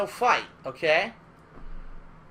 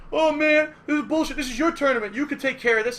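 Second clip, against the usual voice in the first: higher, indignant whining; pissed off; 6 semitones higher, 4 dB louder than usual. Retold or imitated. Angry player blaming organizer, indignant complaint. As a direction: insist it's unfair. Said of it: "he's pissed".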